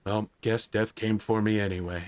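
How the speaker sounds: low, understated, resigned voice; Mortarion. Acted. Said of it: "Mortarion's going".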